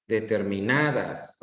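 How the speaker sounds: "Determinadas" lento, con el acento normal en NA. Shown as a demonstration. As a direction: alarga la A de NA en "Determinadas". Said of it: "la sílaba que se escucha mejor es en na". ("Determinadas" con el golpe de voz en NA)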